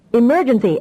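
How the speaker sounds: urgent snap. A narrator's reading. Officer snapping an alarm at the crew. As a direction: bark out the emergency. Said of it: "Ackerman snapped".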